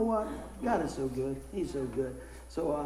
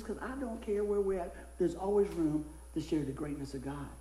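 normal talking voice

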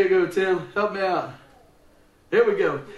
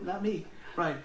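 usual speaking voice

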